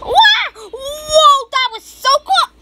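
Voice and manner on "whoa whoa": high excited shout of whoa; thrilled whoa. Real, not acted. Thrilling ride in game.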